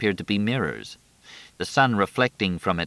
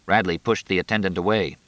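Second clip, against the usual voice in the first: plain reading voice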